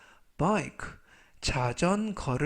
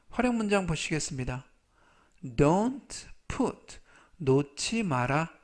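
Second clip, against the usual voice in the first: usual speaking voice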